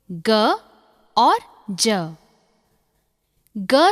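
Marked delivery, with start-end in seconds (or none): 0.1-0.3 short "ga" for the letter G; G as hard "g". Shown as a demonstration. Teacher showing the two sounds of G, hard "g". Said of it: "जी के दो उच्चारण होते हैं". 1.7-2.2 short "ja" for the letter G; G as soft "j". Shown as a demonstration. Teacher showing the two sounds of G, soft "j". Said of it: "जी के दो उच्चारण होते हैं".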